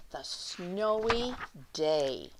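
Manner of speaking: ordinary delivery, nothing marked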